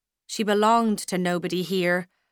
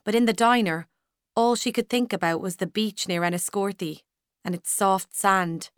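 usual speaking voice

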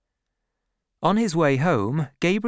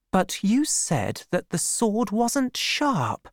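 high, drawn-out puzzled protest; confused; 9 semitones higher, slower than usual. A narrator's reading. Woman challenging Troy about the sword, confused. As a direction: question it in a puzzled, higher voice.

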